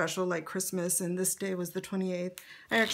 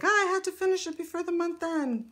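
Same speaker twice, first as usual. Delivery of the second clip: high, louder pretend fluster; hammed-up huff, pitched way up; mock huffy; 10 semitones higher, 5 dB louder, faster than usual. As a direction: exaggerate being worked up over a deadline. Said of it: "get myself in a huffy, like".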